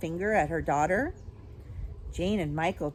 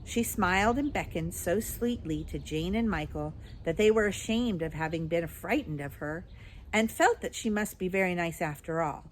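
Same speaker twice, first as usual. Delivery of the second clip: plain reading voice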